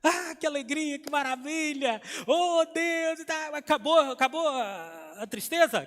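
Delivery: mock rapture, exclaiming to heaven; alegria exagerada. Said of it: "Ficou feliz". (alegria encenada e exagerada)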